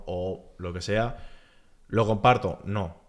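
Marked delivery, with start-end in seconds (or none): none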